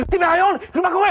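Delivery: frantic worry for his wife; panicked. Retold or imitated. Husband hearing pregnant wife scream, panicked. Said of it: "עכשיו אני נכנס לפאניקה".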